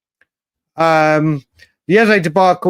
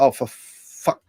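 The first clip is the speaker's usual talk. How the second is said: muttered curse; 4 semitones lower, 5 dB quieter, slower than usual. Retold or imitated. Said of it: "And they're like".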